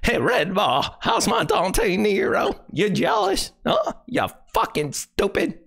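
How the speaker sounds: high, whiny mock voice taunting Red Bar; mocking Red Bar's impression. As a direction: sneer it back in a high put-on voice. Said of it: "I could do a Dante Nero".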